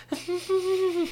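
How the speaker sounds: squeaky high imitation laugh through the nose; mock someone laughing with their nose; mock nasal laugh. Mocking other people's laughs, a high nose laugh. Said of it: "Some people laugh with their nose".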